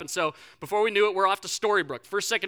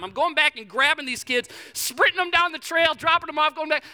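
higher, louder frantic telling; frantic retelling; 7 semitones higher, 6 dB louder than usual. Real, not acted. Counselor reliving the bee attack, frantic and loud. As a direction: tell it like you're reliving the panic. Panicked bee sting rescue.

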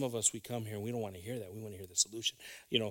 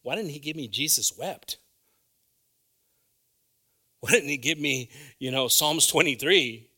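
put-on young self complaining; 4 semitones higher, 11 dB louder than usual.

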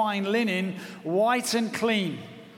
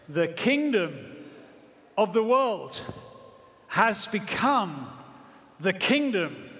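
solemn proclamation. Acted. Proclaiming heaven's voices.

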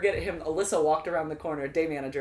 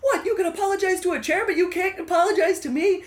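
higher, louder mock yell with laughter; 13 semitones higher, 8 dB louder than usual. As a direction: yell it at the guy on the floor. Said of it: "I yelled something like".

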